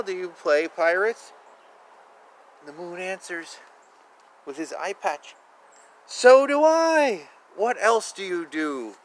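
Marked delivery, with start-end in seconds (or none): none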